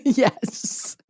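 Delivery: giggling through the answer; breathless laughing confession; laughing yes. Real, not acted. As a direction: laugh as you admit it. Laughing admission of crying more.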